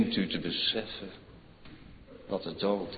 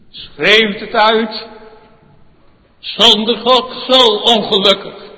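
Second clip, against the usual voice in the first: loud, higher fervent preaching; full-force preaching; 13 semitones higher, 16 dB louder than usual. Acted. (vurig prediken, luid en hoog)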